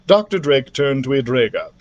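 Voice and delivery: usual speaking voice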